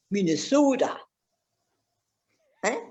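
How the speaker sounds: hammed-up Minnesota vowels; mock Minnesota accent. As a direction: playfully put on a Minnesotan accent. Repeats state name in local accent.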